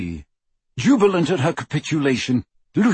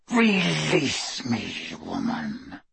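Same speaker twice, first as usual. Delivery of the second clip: slow, soft werewolf snarl, sinking low; 4 semitones lower, 5 dB quieter, much slower than usual. A narrator's reading. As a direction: drag it out through bared teeth. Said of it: "He bared his pointed teeth".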